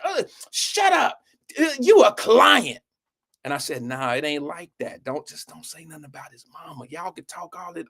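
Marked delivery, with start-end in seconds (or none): 0.0-2.8 high-pitched, drawn-out mock yelling; loud girl. Classmate shutting down the narrator in front of everyone, mock loud and high. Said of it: "She trying to get all loud". 3.8-7.9 hushed, very soft aside; trying to whisper. Retold or imitated. Friend discreetly telling a girl to leave the mother out, near-whisper. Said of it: "I'm trying to whisper".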